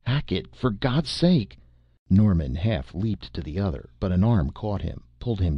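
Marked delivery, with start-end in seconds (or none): 0.0-1.6 higher, urgent acted plea; desperate cry. A narrator's reading. Norman pleads as his friend fights on, desperate raised cry.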